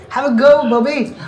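high, loud mock Scottish from the back of the throat; exaggerated Scottish accent demo; throaty Scottish accent. Shown as a demonstration. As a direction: belt out a throaty Scots accent. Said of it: "Gotta do the Scottish accent in the back of the throat".

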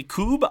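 "cube" said as "coob", without the y sound; whimsical mispronunciation. Shown as a demonstration. Host admitting a silly habit, whimsical mispronunciation. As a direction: drop the y glide from "cube". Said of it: "I am just being stupid".

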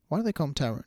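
ordinary delivery

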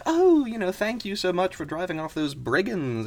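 high-pitched thankful little voice; grateful mouse. Acted. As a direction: start high and effusive, then ease down.

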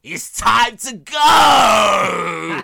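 drawn-out, very high, very loud yell; meme shout. Retold or imitated. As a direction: scream it out slowly like a meme. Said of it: "As Claude said".

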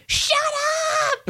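high mock scream at the dogs; sudden high shout of shut up. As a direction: yell it at the barking dogs. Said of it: "mute mic and then scream".

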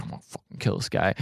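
unvoiced threatening whisper; menacing whisper. [name] threatens Jocko.

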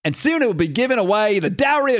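put-on British accent slipping into Australian; high-pitched attempt at British, drifting Australian; botched British accent. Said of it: "no, that's Australian".

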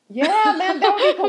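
high laughing through tears; giggling while half crying. Real, not acted. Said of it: "I'm laugh crying".

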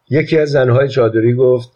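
ordinary delivery

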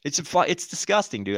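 ordinary talk

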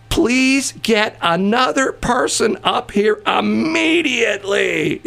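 high, slow, imperious demand; demanding shout. Retold or imitated. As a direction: bark the order slowly, like she owns the place. Rude customer demands another clerk.

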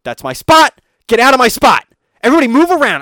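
loud, high, indignant yell; imitating a furious old woman shouting; angry old lady yelling. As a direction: bark at the crowd to clear her spot. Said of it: "She was like".

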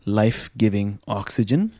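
soft, unhurried guided breathing voice; calm meditative tone. Acted. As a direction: guide them gently through each breath. Leads breathing exercise.

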